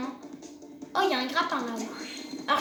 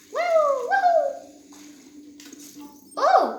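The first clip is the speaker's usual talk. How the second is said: excited cheer; 11 semitones higher, 7 dB louder, much slower than usual. Real, not acted. Cheering on finding a weapon.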